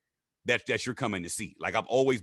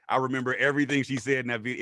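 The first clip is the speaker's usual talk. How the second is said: ordinary delivery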